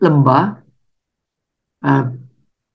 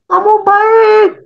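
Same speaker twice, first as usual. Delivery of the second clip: high, slow, far-off echo reply; distant echo; 4 dB louder, slower than usual. Acted. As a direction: sound like the valley answering. Echo answers back. (gema menjawab dari kejauhan)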